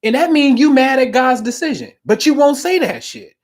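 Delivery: ordinary delivery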